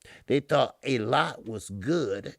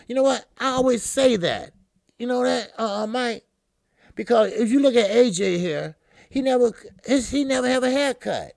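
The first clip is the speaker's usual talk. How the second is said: excited teasing; 12 semitones higher, 10 dB louder than usual. Real, not acted. Excited teasing about barber cuts.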